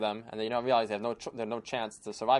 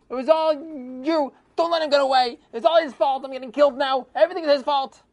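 much higher, much louder, a condemned robber chief shrieking; furious scream; 9 dB louder than usual. Acted. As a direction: scream the blame at the traitor with everything. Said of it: "I was screaming at him".

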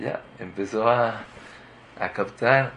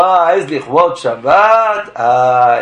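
drawn-out, loud mock sigh of relief; mocking excuse; 3 semitones higher, 11 dB louder, much slower than usual. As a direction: mimic a lazy excuse, slow and loud.

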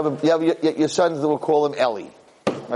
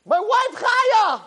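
yelling at wife; much slower than usual. Shown as a demonstration. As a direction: shout at her over the burnt cake. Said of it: "Your wife, right".

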